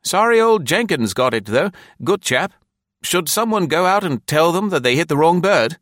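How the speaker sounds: high, loud-edged voice of a sympathetic club man; club officer. Acted. Officer to club mates about the stoned commissioner, high-voiced concern. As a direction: pipe up as a concerned officer, well above your own pitch.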